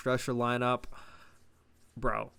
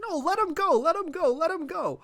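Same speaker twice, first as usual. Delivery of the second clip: much higher, squeaked let him go; mock squeaky insistence; 3 dB louder than usual. Vlogger mocking The Rock, high-pitched let him go. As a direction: squeak it like a cartoon version. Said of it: "The Rock's like".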